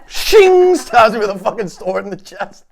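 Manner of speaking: mock heroic. Accepting the death challenge, hammed-up excitement. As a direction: ham up a duel to the death. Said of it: "Challenge accepted".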